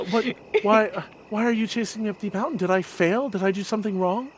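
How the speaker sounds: put-on worried kid asking fast; eager young voice.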